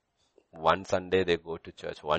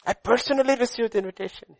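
much higher, louder desperate cry; desperate plea; 10 dB louder, faster than usual. Acted. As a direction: beg as if the door is closing on you. Sinner insisting before the Lord.

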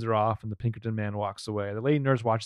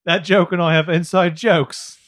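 slow, loud, higher mock Cockney; Cockney accent; 4 semitones higher, 6 dB louder than usual. Co-host repeating the English Ezra bit, Cockney accent. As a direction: play it up as comic Cockney. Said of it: "started doing a Cockney accent".

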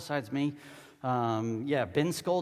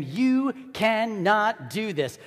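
slow, emphatic reprimand, pitched up a little; angry; 3 semitones higher, 4 dB louder, slower than usual. Acted. Peter pulls Jesus aside to reprimand him, angrily. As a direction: scold with every word weighted. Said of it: "He angrily told him".

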